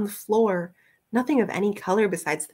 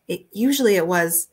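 plain reading voice

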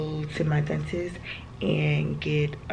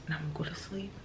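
soft unvoiced whisper; 13 dB quieter, faster than usual. Real, not acted. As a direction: whisper it sleepily. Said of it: "I'm just gonna talk like this".

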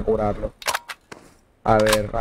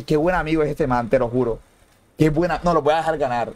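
usual speaking voice

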